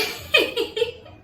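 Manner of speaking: high, loud laughing burst; burst of laughter. Real, not acted. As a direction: laugh at the memory. Laughs at own impression.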